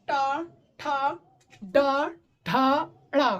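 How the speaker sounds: टा ठा डा ढा ड़ा spoken slowly, one by one, with the tongue tip curled back; drawn-out डा ढा with the tongue curled back; retroflex syllable drill. Shown as a demonstration. Teacher showing students retroflex sounds, carefully articulated. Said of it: "यहाँ पे focus करेगा".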